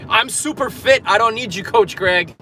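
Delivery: playful imitation of a confident viewer; mock cocky viewer. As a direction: toss it off like a smug gym regular. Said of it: "just type".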